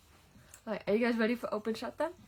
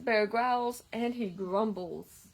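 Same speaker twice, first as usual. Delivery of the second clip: lively storybook read-aloud; reading to children; 6 dB louder, slower than usual. A narrator's reading. Reading a picture book to children, lively storytime voice. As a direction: read it like story time for little kids.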